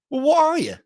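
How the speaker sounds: cornering the canvassers with a taunting question; mock challenging question. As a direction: press them hard.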